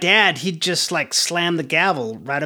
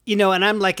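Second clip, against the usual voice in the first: ordinary delivery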